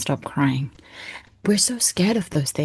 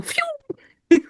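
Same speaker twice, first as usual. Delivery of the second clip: mock exasperated phew. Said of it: "She's like".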